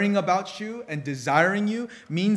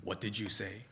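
menacing dad; 7 semitones lower, 10 dB quieter than usual. Retold or imitated. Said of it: "The anger that welled up".

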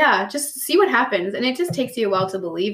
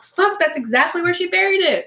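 amazed realization; 9 semitones higher than usual. Retold or imitated. Confirming where the bone was.

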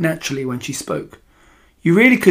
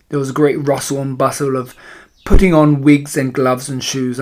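plain reading voice

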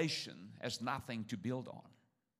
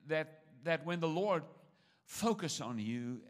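ordinary talk, nothing marked

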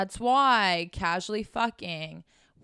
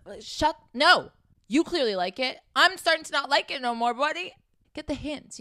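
higher and louder, fed up; annoyed, telling him to back off; exasperated; 7 semitones higher, 5 dB louder than usual. Real, not acted. Annoyed outburst at the guy.

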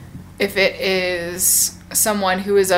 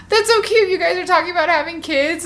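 very high, mock-gushy squeal; high coo easing down; gushing friend; 9 semitones higher than usual. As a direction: imitate an over-delighted friend. Friend gushing at her news.

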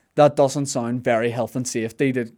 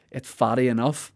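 ordinary delivery

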